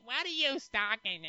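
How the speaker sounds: high, whiny impression of mom; mock mother voice. Son mocking his mom who can't keep up, whiny. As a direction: imitate a befuddled mother in a high voice.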